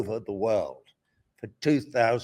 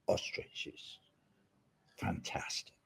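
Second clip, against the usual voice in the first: voiceless, near-whispered delight; hushed amusement; 6 semitones lower, 12 dB quieter, slower than usual. Real, not acted. Retelling the plutocrats eaten by ostriches, hushed amusement. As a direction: let it out softly before a laugh. Savouring absurd film ending, then laughs.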